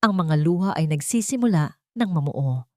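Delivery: ordinary delivery, nothing marked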